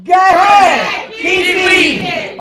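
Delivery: high, drawn-out shouted show title; announcer's title call. Pretend. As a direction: stretch each word like a big stage announcement.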